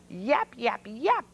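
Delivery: high, quick yapping bark; yapping dog. A narrator's reading. Dog chasing the chickens, high yapping. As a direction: yap like a small dog. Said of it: "barked a dog".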